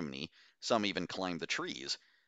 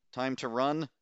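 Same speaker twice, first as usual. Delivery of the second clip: high nervous squeak; a touch of a squeak, higher; 6 semitones higher, 3 dB louder than usual. A narrator's reading. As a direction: squeak it out, a little scared. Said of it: "Castile squeaked".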